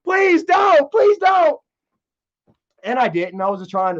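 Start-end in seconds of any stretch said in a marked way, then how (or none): 0.0-1.6 imitating a high, loud sobbing plea; hammed-up crying begging; mock crying plea. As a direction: beg through fake tears, higher and louder. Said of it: "started crying and he was like".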